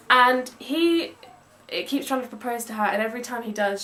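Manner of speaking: usual speaking voice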